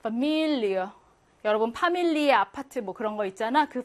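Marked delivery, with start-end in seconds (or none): 0.0-0.9 파밀리어 with 밀 stressed, ending in a weak 이어. Shown as a demonstration. Teacher showing where stress falls, 파밀리어 stressed on 밀. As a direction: stress the middle of 파밀리어, then relax into 리어. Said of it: "강세가 미 가운데에 있기 때문에".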